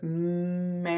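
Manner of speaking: "Man" drawn out slowly; stretched slowly. Shown as a demonstration. Teacher stretching the word so kids count its sounds. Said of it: "How many sounds".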